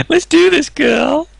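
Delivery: excited. Acted. Excited cheer.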